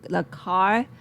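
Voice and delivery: ordinary talk, nothing marked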